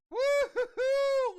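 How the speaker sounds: loud, high whooping celebration; thrilled yelling woo-hoo; excited cheer. Real, not acted. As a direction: whoop it out at a big pull. Big card pulled, cheering.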